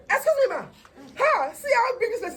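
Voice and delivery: high, loud alarmed cry; a startled husband blurting out in shock. Retold or imitated. Husband shocked at the size during delivery, loud and high.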